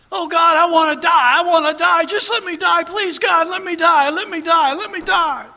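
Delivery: high, loud anguished pleading; screaming to die. Retold or imitated. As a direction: cry out, begging God for death. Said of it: "he'd start screaming".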